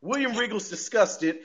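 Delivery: normal talking voice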